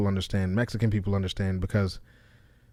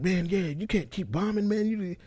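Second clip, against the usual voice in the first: mock friend; 10 semitones higher than usual. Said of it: "people be like".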